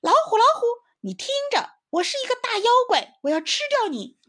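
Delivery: very high, loud, fast monster boast; loud shout. Pretend. Squirrel shouts at the tiger, pretending to be a monster. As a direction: roar like a big demon to scare him. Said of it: "它大声叫了起来".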